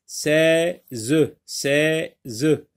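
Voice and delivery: "seize" split into sei... ze, final e voiced; syllable by syllable. Shown as a demonstration. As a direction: say "seize" as two beats, sè, then zeu. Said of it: "Dans la première syllabe".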